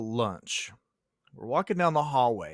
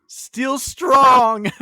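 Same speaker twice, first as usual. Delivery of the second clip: much higher, louder triumphant shout; proud shout; 10 semitones higher, 12 dB louder than usual. Retold or imitated. Teacher answering the teasing kid, triumphant mock shout. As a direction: shout it back like a victory cry. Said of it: "hold up my salad and be like".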